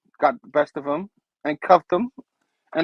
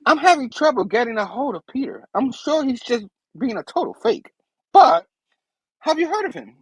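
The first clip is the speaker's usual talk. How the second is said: lightly acted high-pitched plea to hold on; higher voice, stalling someone on the phone; eager young caller; 8 semitones higher than usual. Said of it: "And she's like".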